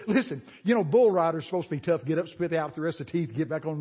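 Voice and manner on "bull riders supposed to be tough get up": ordinary talk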